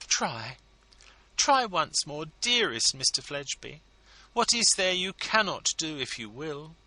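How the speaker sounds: high, coaxing, ladylike entreaty; wife begging a favour for her husband, high and coaxing; pleading society lady. A narrator's reading. Mrs Lammle pleading.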